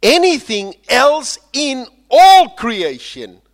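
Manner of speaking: slow, loud, high cry; soaring proclamation. Acted. Preacher crying out Paul's promise to the congregation. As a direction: cry it out slowly to the rafters. Climax of Romans quote.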